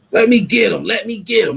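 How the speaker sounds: high, loud mocking imitation of him; mock quote. Mocking the other streamer's own words back at him. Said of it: "That's what you said".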